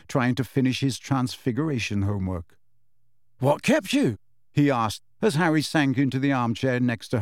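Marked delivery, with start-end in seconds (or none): 3.4-4.2 higher-pitched, lightly voiced boy's question; Ron's question. A narrator's reading. Ron to Harry as he joins them by the fire, curious. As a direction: ask it high and curious. Ron asks his friend on arrival.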